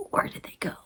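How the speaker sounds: a puzzled whisper; whispered question. Retold or imitated. Waiting in the empty lobby, puzzled whisper. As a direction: whisper it under your breath.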